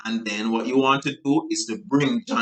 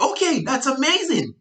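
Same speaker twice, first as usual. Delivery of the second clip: overeager reply; 13 semitones higher than usual. Shown as a demonstration. Salesperson gushing back at an unexcited client, over-the-top. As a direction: burst in with over-the-top cheer. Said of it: "No, you don't want any of that".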